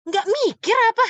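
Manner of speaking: sharp rebuke, pitch leaping on apa. Shown as a demonstration. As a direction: fling the question at them. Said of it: "kenapa dinaikkan suara seperti".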